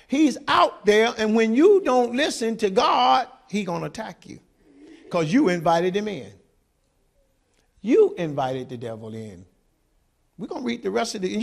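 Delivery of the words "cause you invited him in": ordinary delivery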